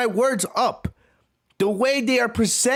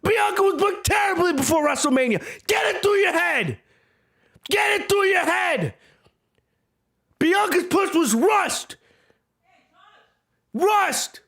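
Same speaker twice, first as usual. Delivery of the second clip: mad shouting; 6 semitones higher than usual. Real, not acted. Host shouting down fans defending Bianca, angry. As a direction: drill it into their heads, furious. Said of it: "Now I'm getting mad".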